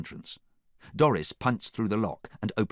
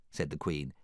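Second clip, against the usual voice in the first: plain reading voice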